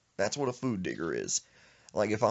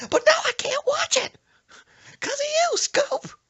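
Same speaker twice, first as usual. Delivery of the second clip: shrill high shriek of a furious grandma, much louder; sudden mood change; 9 dB louder than usual. Retold or imitated. Grandma turns on the grandson, shrieking high and loud. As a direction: flip from sweet to screeching. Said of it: "just had a mood change".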